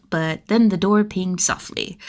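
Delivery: plain reading voice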